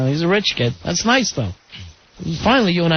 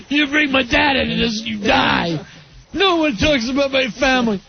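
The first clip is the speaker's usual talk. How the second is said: put-on drunken threat; 6 semitones higher than usual. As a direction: growl it like a drunk defending his family. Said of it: "that's how you know Rory's done drinking".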